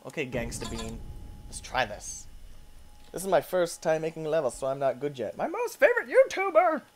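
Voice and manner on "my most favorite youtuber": squeaky fan voice. Pretend. Reads level title mockingly.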